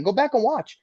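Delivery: very high-pitched retold argument; insistent accusation. Driver repeating himself at rival, heated and insistent. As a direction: keep hammering the point, voice way up.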